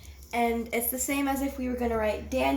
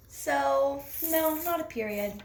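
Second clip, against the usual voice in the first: usual speaking voice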